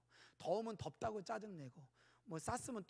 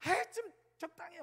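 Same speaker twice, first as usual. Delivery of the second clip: higher, louder fed-up scold; irritated scolding; 10 semitones higher, 8 dB louder than usual. Retold or imitated. Father scolding fussy son, irritated louder scold. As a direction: tell the kid to knock it off, sharply. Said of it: "막 이런, 이런 적이".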